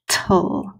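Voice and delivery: "Tull" said on its own, last one whispered; teacher modelling the second syllable; sounding out syllable. Shown as a demonstration. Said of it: "my second syllable".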